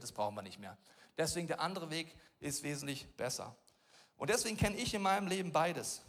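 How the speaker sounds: normal talking voice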